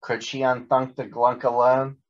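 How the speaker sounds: ordinary delivery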